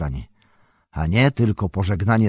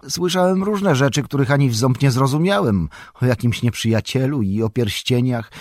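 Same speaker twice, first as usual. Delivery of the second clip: higher, eager Sam voice; eager young servant; 6 semitones higher, 3 dB louder than usual. A narrator's reading. Sam begs Frodo to take him to the elves, eager. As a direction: spill it all out, wide-eyed and keen.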